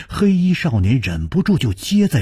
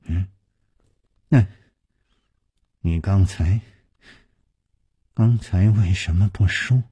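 low, hesitant, broken phrasing; trembling voice; 4 semitones lower than usual. A narrator's reading. Youth asking why the truth was withheld, voice trembling. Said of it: "颤声说".

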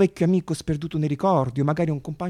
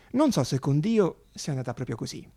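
usual speaking voice

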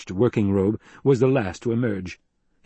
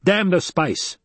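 sharp high-pitched bark, not shouted; barked retort; 9 semitones higher, slower than usual. A narrator's reading. Duke overruling crew about the spice, restrained bark. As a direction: bark it back dismissively, pitched up. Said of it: "the Duke barked".

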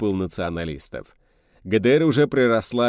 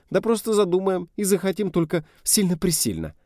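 put-on girl's voice; 10 semitones higher than usual. A narrator's reading. Girl voiced in story.

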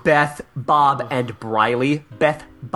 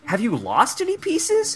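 mock prim columnist; 10 semitones higher than usual. Reading a column, voicing the writer.

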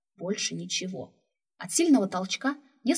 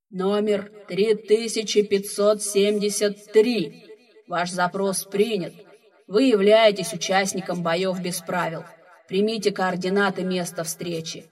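heavy booming voice from the speakers; slow, loud, echoing announcement; echoing ethereal energy being; 7 dB louder, slower than usual. Acted. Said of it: "тяжёлый гулкий голос, вещающий из динамиков". (тяжёлый гулкий голос из динамиков)

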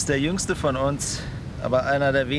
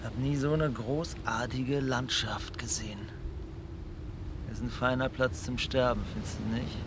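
hushed; 8 dB quieter than usual. Acted. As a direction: speak quietly, as if to yourself. Quiet talk about dying on island.

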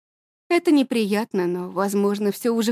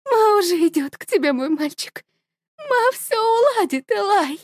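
tender farewell; 10 semitones higher than usual. A narrator's reading. Mother tells her dead son she is coming, high and tender. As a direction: soothe a lost child.

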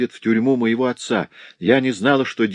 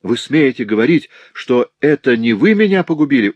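higher, louder accusing challenge; indignant outburst; 5 semitones higher, 6 dB louder than usual. A narrator's reading. Servant girl accuses her master of ruining her, loudly indignant. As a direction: escalate into heated outrage. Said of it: "возмущённо воскликнула я".

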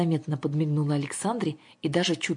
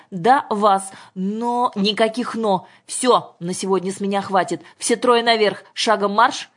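loud, higher no-nonsense orders; commanding; 4 semitones higher, 6 dB louder than usual. A narrator's reading. Father sends all three upstairs, commanding. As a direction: cut off argument and order them out. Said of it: "скомандовал Мишин".

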